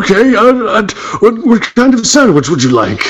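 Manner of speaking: high, hesitant shop butcher. Acted. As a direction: play a nervous deli butcher. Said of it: "That's my butcher voice".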